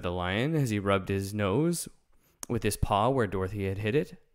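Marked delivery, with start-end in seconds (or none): none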